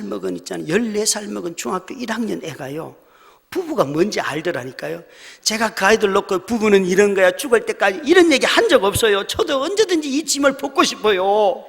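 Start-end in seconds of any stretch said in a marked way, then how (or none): 5.4-11.6 loud, high insistence from the pulpit; rising protest. Real, not acted. Pastor insists to the congregation, loud and high. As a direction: raise the voice, pleading to be believed. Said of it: "pastor protests, voice rising".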